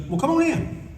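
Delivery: high, put-on old man's welcome; high, mock-genial invitation; imitating Eisner. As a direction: welcome him in as the old master would. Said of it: "And he goes".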